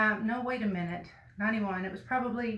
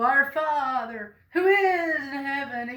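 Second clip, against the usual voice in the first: loud, slow, drawn-out churchy intoning; hammed-up formal praying, raised and slow; mock pompous prayer; 4 semitones higher, 6 dB louder, slower than usual. Shown as a demonstration. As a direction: intone it grandly, stretching every word. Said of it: "like, you gotta sit around and go".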